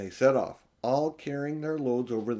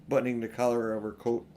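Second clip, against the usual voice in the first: usual speaking voice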